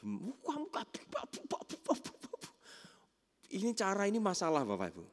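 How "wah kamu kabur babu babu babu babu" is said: whispered sputtering scolding; mock tirade under the breath; mock judgmental rant. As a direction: mime a telling-off in unvoiced babble. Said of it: "Langsung dihakimi".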